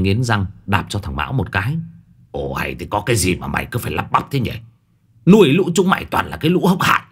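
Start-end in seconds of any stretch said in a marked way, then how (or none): 2.3-7.0 fit of rage. Acted. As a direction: lash out at the useless servants. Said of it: "Thấy lão lên cơn".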